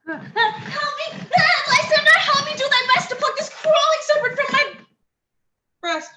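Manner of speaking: high, fast terrified plea; high frantic call; panicked cry. Acted. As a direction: scream for help from a nightmare. Hermia waking from nightmare.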